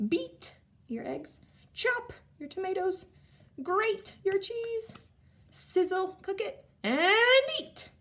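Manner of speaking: action words read high and lively; excited. Shown as a demonstration. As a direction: chant the cooking steps with glee. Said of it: "now let's do our action words".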